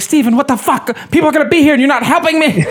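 very high, fast, laughing mock scold; imitating his mom scolding. Mom scolding Steven for not helping, high fast mock scold. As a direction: rattle it off like a frazzled mom. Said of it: "And she's like".

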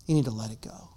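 soft unvoiced plea; whisper. Real, not acted. Pastor urging the congregation to forgive, whisper. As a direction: lean in and whisper it. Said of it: "whispered plea".

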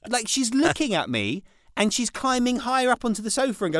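ordinary talk, nothing marked

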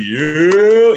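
loud, high hype shout; hyped cheer. Retold or imitated. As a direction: cheer like the boys in the cab. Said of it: "They're like".

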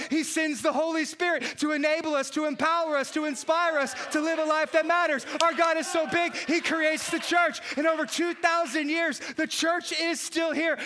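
loud, driving sermon delivery, every line punched; full-force preaching. Acted. Pastor rousing the congregation, preaching at full force. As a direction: preach it to the back row with fire.

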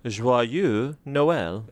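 slow imitated French pronunciation; French accent. Host copying the man on YouTube, French accent. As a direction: copy a French pronunciation guide, slowly. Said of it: "According to the man on YouTube".

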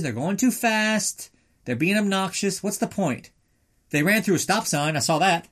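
lightly voiced grumpy neighbour complaining about dirt bikes; mock cranky neighbour. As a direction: play a scandalised busybody. Grumpy onlooker ranting about hooligans.

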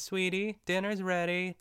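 imitating a sweet sitcom housewife summoning her husband; 1950s housewife voice. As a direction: call down the hall like a picture-perfect fifties wife. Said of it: "best nineteen fifties housewife voice".

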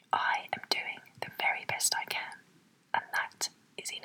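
whispered under the breath. Shown as a demonstration. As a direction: whisper it to yourself. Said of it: "Say that to yourself right now".

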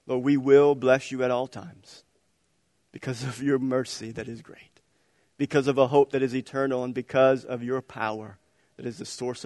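soft, low prayer voice; closing prayer. Real, not acted. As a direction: pray over them gently.

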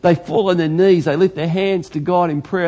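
ordinary talk, nothing marked

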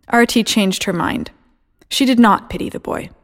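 usual speaking voice

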